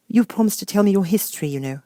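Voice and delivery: lightly voiced Alice. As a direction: ask like a curious young girl.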